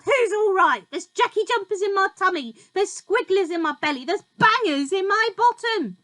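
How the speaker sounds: high, loud screechy complaint; cranky old grandma. A narrator's reading. Grandma complaining to George, loud and screechy. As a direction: wail it like a cross old woman.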